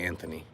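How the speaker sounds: Anthony said with a clear "th" sound, not a "t". Shown as a demonstration. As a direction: say "Anthony" with a soft th, as in "thin". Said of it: "I definitely say the T H".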